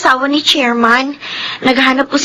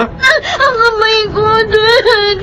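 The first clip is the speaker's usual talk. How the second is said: higher, tearful cry of pain; 7 semitones higher than usual. Acted. Hurt daughter crying to her father about her hand. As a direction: cry out that it hurts.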